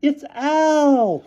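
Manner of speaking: put-on high loud girlish call; cried. A narrator's reading. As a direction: cry it out delighted. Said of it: "cried Kathy".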